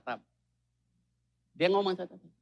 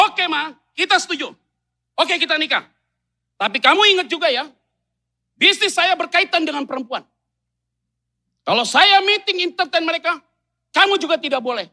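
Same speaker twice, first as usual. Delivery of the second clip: high, loud counter-terms; mock groom answering his bride in kind; tit-for-tat groom; 11 semitones higher, 10 dB louder than usual. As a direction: agree then strike back.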